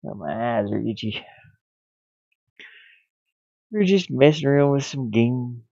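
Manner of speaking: ordinary delivery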